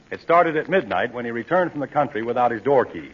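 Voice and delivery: usual speaking voice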